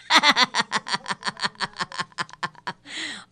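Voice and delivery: long rattling run of laughter. Real, not acted. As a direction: laugh on and on in a steady string. Se ríe de su chiste. (carcajada larga y seguida)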